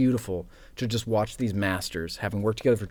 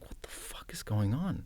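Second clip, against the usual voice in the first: slow retold whisper, much softer; whispered bafflement; 2 semitones higher, 9 dB quieter than usual. Nervous actor retells reaction to director, whispered bafflement.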